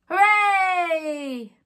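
cheer. Acted. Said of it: "Everyone cheered".

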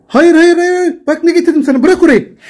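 high, loud, rapid-fire "no"; urgent scolding. Real, not acted. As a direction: urgently stop a misbehaving pet. Stopping the cat from doing damage.

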